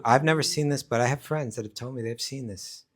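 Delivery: usual speaking voice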